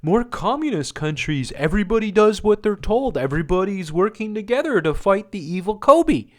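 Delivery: high, mocking voice of a true believer; mocking brainwashed believer. Vlogger mocking people who trust the news, high mock voice. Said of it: "They want you to believe".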